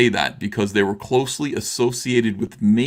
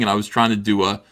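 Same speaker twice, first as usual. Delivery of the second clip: ordinary talk, nothing marked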